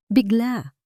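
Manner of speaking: ordinary delivery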